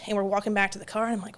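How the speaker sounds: ordinary talk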